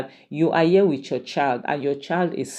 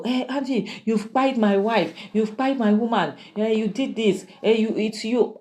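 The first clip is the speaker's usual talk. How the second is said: high, blaming you did this; accusing cry; 7 semitones higher than usual. Retold or imitated. AGK blaming Andy over his fallen wife, high accusing cry. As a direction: point the finger in panic.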